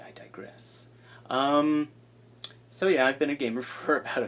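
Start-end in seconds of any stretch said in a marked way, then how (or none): 0.0-0.9 a hushed self-correction; whispered aside. Real, not acted. YouTuber whispering as he gets back on track. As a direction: whisper I digress to yourself.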